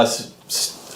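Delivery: ordinary delivery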